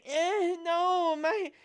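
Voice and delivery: high-pitched whimpering plea; hammed-up whiny pleading with little cries; mock begging. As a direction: act out a helpless beg in a squeaky voice. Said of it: "start begging for mom and going".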